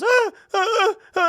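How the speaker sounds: high, loud fake wail, hammed up; mock wailing sob. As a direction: wail like a grown man melting down over a splash. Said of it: "he goes".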